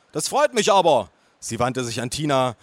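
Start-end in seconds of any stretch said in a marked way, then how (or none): 0.1-1.1 high, raised aunt voice; bellowing aunt. Acted. Aunt pleased at the news, bellowing. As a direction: gush loudly as the aunt. Loud aunt greets nephew.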